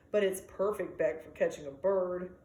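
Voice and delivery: usual speaking voice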